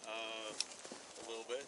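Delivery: thick tongued mumble; slurred speech. Real, not acted. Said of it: "You're slurring, you're slurring your words".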